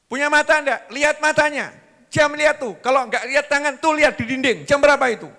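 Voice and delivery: high, loud nagging; furious wife berating her husband for coming home late; angry scolding wife. Acted.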